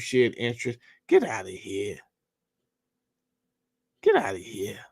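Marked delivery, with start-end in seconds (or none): 1.1-2.0 low, scornful brush-off; dismissive scoff. Real, not acted. Scoffing at the bill's wording. 4.0-4.8 a scornful whisper to himself; whispered scoff. Real, not acted. Repeats the dismissal, whispered.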